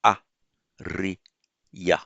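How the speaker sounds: a, ri, ya split by clear pauses; syllable by syllable. Shown as a demonstration. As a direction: spell out the sounds a... ri... ya. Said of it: "change that into two light syllables".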